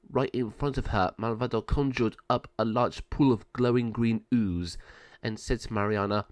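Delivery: ordinary delivery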